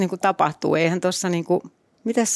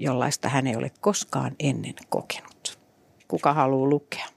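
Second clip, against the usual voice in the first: hushed, breathless whisper; 5 semitones lower, 6 dB quieter than usual. Acted. Teasing the co-host with a whispered book blurb, whispered. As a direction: drop to a secret whisper to tease the listener.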